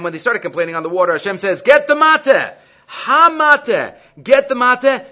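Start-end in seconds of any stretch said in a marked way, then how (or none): 1.6-5.0 high, loud quoted order; raised-voice divine instruction, acted by a teacher; emphatic command. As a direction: call out the order, drawing attention to the object. Acting out Hashem's command to Moshe.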